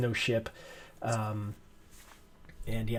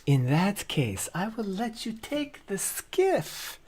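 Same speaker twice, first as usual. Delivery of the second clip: a higher, slower shopkeeper voice; lightly voiced NPC; 9 semitones higher, slower than usual. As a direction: play the merchant handing over a skiff. Shopkeeper NPC's reply.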